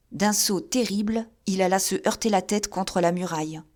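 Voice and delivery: usual speaking voice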